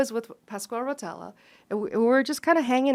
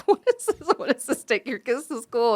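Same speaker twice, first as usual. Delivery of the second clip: incredulous; 2 semitones higher than usual. Retold or imitated. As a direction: scoff in disbelief. Organizers baffled by school run.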